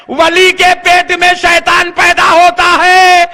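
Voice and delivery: slow, drawn-out shouted sarcasm; fiery mocking preaching. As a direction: proclaim the mock wonder to a crowd. Preaching at full force. (طنزیہ، پرجوش خطیبانہ للکار)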